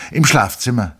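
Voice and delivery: high, loud servant's answer; the orderly calls out the answer, loud and high; servant's reply. A narrator's reading.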